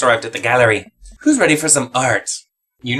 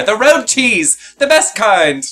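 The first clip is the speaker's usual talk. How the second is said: slow, high, gleeful exclamation; drawn-out excited cheer; delighted; 7 semitones higher, 4 dB louder, much slower than usual. Acted. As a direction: savour each word of the treat. Excited about cheese cup.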